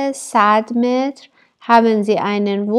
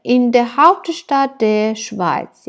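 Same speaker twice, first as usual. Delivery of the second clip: slow dictation reading; much slower than usual. Shown as a demonstration. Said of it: "teacher repeats German phrase".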